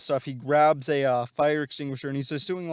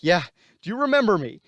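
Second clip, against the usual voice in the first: higher, louder, the question thrown at him; pointed shouted question; 5 semitones higher, 6 dB louder than usual. Retold or imitated. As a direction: make him remember you, loud and sharp. Spidey confronts uncle's killer.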